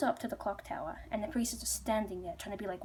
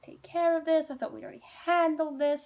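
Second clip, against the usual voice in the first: raised, louder mock exasperation; exasperated complaint; 6 semitones higher, 6 dB louder than usual. Ghosts find a guy in their house, exasperated. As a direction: grumble loudly, pitched up. Ghosts grumble about the intruder.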